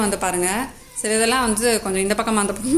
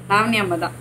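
ordinary talk, nothing marked

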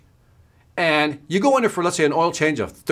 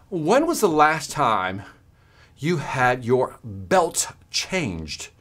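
slow probing question; much slower than usual. Retold or imitated. Adviser asks slowly.

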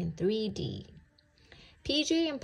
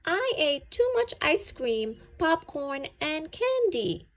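high, loud sorry-for-himself whine; bunny moaning to his mom after the movie; whiny complaint; 8 semitones higher, 4 dB louder than usual. A narrator's reading. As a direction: whine about the tummy ache. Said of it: "he told her".

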